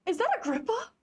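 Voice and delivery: high, loud stunned question; real startled recognition; shocked disbelief. Real, not acted. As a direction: blurt it out in sudden alarm. Shocked at discovering the character.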